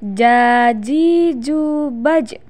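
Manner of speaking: very slow syllable-by-syllable drill; slow chanted model for pupils; drawn-out demonstration. Shown as a demonstration. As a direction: stretch every vowel evenly. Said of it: "tahan suaranya".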